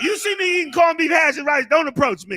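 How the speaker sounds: loud, high-pitched playful threat; mock warning. Warning people away from his corned beef, mock-threatening. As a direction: warn them off loudly, in jest. Comic warning over favourite food.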